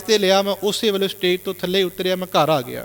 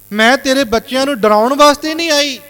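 higher and louder, pleading mother; emotional plea; 6 semitones higher, 6 dB louder than usual. Retold or imitated. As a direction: beg him to believe her. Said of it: "ਭਾਵੁਕ ਹੋ ਕੇ ਸ਼ਬਦ ਬੋਲੇ". (ਉੱਚੀ ਤੇ ਭਾਵੁਕ ਅਵਾਜ਼ ਵਿੱਚ ਤਰਲਾ)

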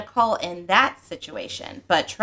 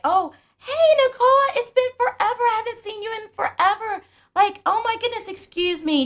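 much higher, bright fake-friendly voice; Carlos's fake greeting; 11 semitones higher than usual. Carlos to Nicole in front of cameras, much higher gushing greeting. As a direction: gush like meeting an old friend, pitched way up. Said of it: "he's like".

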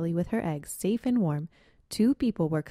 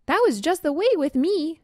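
higher, eager skunk voice; eager young skunk; 10 semitones higher, 5 dB louder than usual. Acted. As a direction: jump in to agree. Spotted Skunk agrees.

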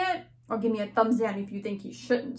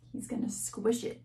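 hushed whisper, half to herself; a dramatic storyteller's whisper; 3 semitones lower, 9 dB quieter than usual. Acted. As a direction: share the fear in a whisper.

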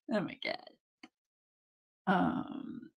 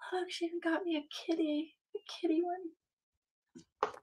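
cooing whisper; 8 semitones higher, 8 dB quieter than usual. Real, not acted. Showing chat the kitty sticker, soft cooing whisper. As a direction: coo it like over a kitten.

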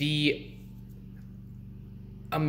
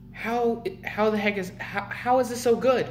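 higher-pitched, incredulous re-enactment; amazed disbelief; 8 semitones higher than usual. Retold or imitated. Burger fan marveling at his favorite cheeseburger, high-pitched amazement. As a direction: act out baffled wonder in a high voice. Retelling own amazed reaction.

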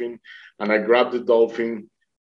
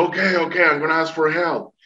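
retold urgent agreement; 5 semitones higher, faster than usual. Retells answering the voice, urgent.

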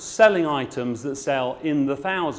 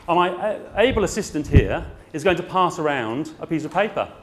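usual speaking voice

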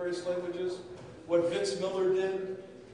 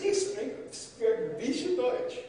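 much higher, slow halting broken German; clumsy German; 6 semitones higher, slower than usual. Retold or imitated. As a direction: fumble the German like a beginner. Soldier tries broken German.

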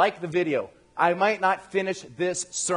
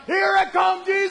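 high, loud cry to heaven; ecstatic mock shout; 10 semitones higher, 5 dB louder than usual. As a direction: shout as if the rapture has arrived. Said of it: "Dave thought it was the rapture".

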